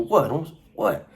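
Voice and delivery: high, taunting "What, Holmes?"; mock tough-guy challenge. Storyteller mocking a junior-high challenge, taunting. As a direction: sneer it like a schoolyard punk. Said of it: "You do that stupid fucking".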